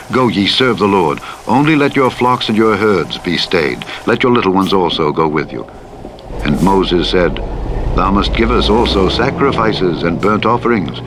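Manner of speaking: normal talking voice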